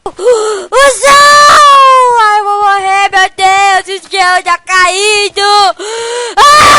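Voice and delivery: loud, high panicked screams; terrified screaming. Real, not acted. Gamer watching the sky fall in the game, terrified screams. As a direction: scream like you're about to die. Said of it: "tô com medo".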